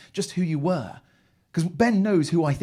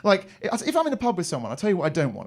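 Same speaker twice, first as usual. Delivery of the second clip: ordinary talk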